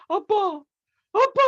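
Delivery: high, pleading imitation of a daughter crying out; mock crying daughter. The daughter cries out to her father's image, mock-tearful and high. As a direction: imitate a daughter calling through tears. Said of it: "하면서 막 울어".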